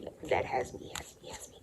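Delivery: soft, voiceless repetition; hushed dread. Real, not acted. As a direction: let the words drain away in dread. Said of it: "retelling being scolded".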